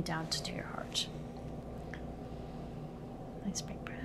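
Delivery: soft breathy whisper to the patient. Acted. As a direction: whisper it gently, close to the ear. Whispered asides during exam.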